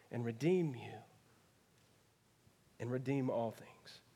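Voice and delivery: soft, low and sincere; tender, quiet close. Real, not acted. Preacher softly tells the congregation, tender and low. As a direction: speak it gently like a promise.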